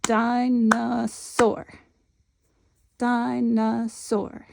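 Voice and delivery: dinosaur drawn out syllable by syllable; very slow clapping of the word. Shown as a demonstration. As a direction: clap each part, di, no, saur. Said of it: "we're gonna count the claps".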